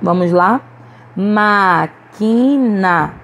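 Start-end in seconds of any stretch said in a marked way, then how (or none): 1.2-3.1 "Máquina" very slow, in three syllables, strong Má; stress on antepenultimate syllable. Shown as a demonstration. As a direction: stretch out "Máquina", leaning on the first syllable. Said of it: "que nós pronunciamos com mais força". ("Máquina" bem devagar, silabado, com força em Má)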